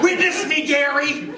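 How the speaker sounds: high shrieking Witness me; Mad Max war cry. Retold or imitated. Artist mocking Gary like a Mad Max extra, high shriek. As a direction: scream it like a Mad Max fanatic. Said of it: "like extras from Mad Max".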